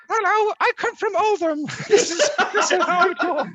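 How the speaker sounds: laughing through a hammed-up Oldham accent; mock Oldham accent. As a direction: take the mick out of Oldham folk in a high Oldham accent. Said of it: "impression of a, of an accent?".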